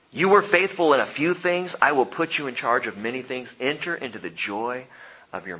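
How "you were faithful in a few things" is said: ordinary delivery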